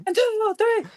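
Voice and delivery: very high, musical count-in; bandleader count-off. Retold or imitated. [name] counting off the shuffle for her, exuberant count-in.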